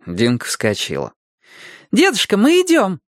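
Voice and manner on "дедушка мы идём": put-on high-pitched child's call; child calling back. A narrator's reading. Girl answering grandfather's supper call, high and calling.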